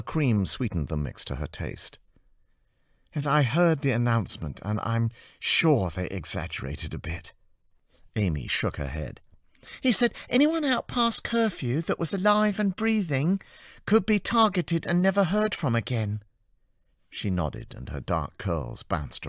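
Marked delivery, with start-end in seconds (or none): 3.1-7.3 put-on somewhat higher, strained young woman; firm but worn; tired artist. Anna defending her deadline to Amy, tired and firm. 9.8-16.2 put-on much higher, chirpy young woman's voice; bubbly and earnest with a warning; cheerful assistant. Amy warning Anna about the curfew, in a high cheerful voice.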